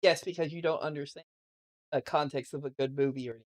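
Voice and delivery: low, quiet deadpan sarcasm; dry sarcastic put-down, kept soft; mock-agree with dry sarcasm. Real, not acted. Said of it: "That was sarcasm".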